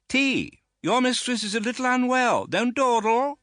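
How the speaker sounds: high, loud brisk orders; commanding aunt. A narrator's reading. Aunt ordering the servant to fetch tea, high and commanding. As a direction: snap out the errand.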